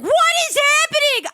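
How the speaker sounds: high, loud mock-horrified cry; retold shock. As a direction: squeal it in exaggerated disbelief.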